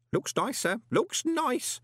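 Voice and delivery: high, eager character voice; pleased old underling. Acted. Grote approves of the sign to his boss, high and pleased. Grote admires the restored letters.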